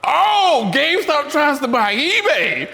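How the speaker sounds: high, loud stunned realization; shocked. Real, not acted. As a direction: voice jumps up in disbelief. Realizing the reverse headline.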